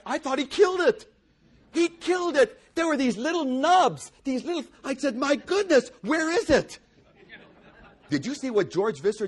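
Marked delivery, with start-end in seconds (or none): 0.0-6.8 high, loud dismay at the bare vine; loud, high retold shock at the trimmed grapevine; mock horror. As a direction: cry out as if the vine were dead.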